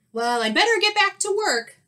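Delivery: high, loud breezy bear; sunny storybook bear voice; cheerful bear. Acted. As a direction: chirp it, ready to go. Bear rises cheerfully from rest.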